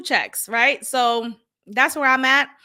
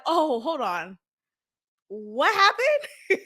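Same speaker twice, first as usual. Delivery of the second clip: surprised; 5 semitones higher, slower than usual. Retold or imitated. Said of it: "I'm like".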